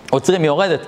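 usual speaking voice

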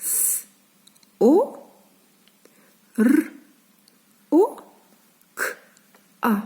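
each letter of "с, о, р, о, к, а" as its own short sound. Shown as a demonstration. Teacher showing children the sounds, "с, о, р, о, к, а" one at a time. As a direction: say bare sounds "с, о, р, о, к, а" with long pauses. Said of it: "маємо такі звуки".

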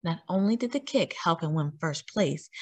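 usual speaking voice

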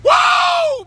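very high joyful woo; excited whoop. Real, not acted. Man whooping to viewers in celebration. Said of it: "Yeah, I'm excited".

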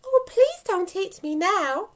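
high, loud panicked begging; terrified plea. A narrator's reading. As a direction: beg in a high-pitched fright. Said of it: "her heart pounding and her blood fizzing".

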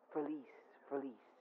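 soft, breathy whisper; whispered under the breath; whispered repeat. Real, not acted. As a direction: whisper it to yourself. Whispered aside.

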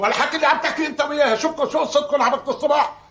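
fast, high-pitched intervention; exasperated scolding. Acted. Relative breaking up the couple's morning quarrel, exasperated. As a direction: cut in quickly with pitch jumping up.

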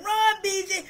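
high, loud cheering from the stands; grandma shouting her grandson to run. Retold or imitated. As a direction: yell him on down the field. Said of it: "you gonna hear my voice saying".

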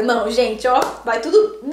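ordinary talk

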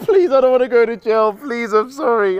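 high, loud mock begging; fake scared pleading. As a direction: ham up begging not to go to jail. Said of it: "stop pretending you're really scared".

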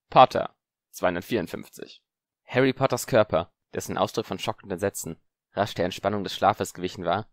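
usual speaking voice

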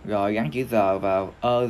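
ordinary delivery

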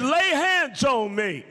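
high, slow, insistent cry; retold command. Sick woman ordering preacher to pray, high retold command. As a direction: call it out as an urgent demand.

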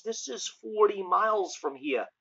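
usual speaking voice

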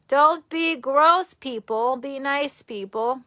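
drawn-out loud scolding, rising on gross; stern scold. Real, not acted. Streamer scolding gross viewers in chat, slow loud rebuke.

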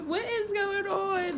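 high, drawn-out imitation of a baffled friend; slow, high mock bewilderment with laughter; laughing mimicry. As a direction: mimic her confusion while cracking up. Mimics pranked friend while laughing.

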